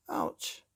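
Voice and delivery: a high yelp of pain; father yelping as he sits down; sharp yelp. A narrator's reading. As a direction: cry out as if stung.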